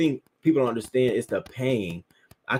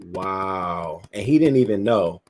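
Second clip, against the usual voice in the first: ordinary delivery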